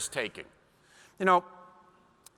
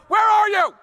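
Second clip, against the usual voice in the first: put-on panicked child; 11 dB louder than usual. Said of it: "and they go".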